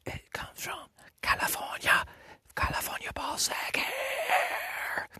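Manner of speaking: mock whisper. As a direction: whisper and stretch it. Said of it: "whispered tease".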